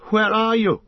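loud high cry with anger and fear; frightened angry call; angry and afraid. Acted. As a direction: shout into the dark, scared and furious. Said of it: "both angry and afraid".

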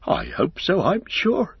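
high, swooping innkeeper voice; flustered innkeeper. A narrator's reading. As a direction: answer as a flustered country landlord. Innkeeper replies, still amazed.

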